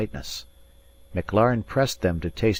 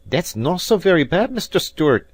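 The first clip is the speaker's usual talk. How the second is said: higher, louder, condescending; haughty Scots piper talking down; condescending rival; 11 semitones higher, 6 dB louder than usual. Acted. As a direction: patronise him sweetly. Rival's backhanded compliment.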